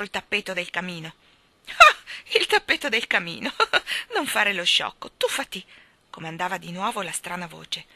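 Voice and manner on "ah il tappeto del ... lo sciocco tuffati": loud, high mocking echo with a laugh; scoffing. A narrator's reading. Strange voice mocks the boy's worry, scoffing. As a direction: scoff loudly at a silly objection.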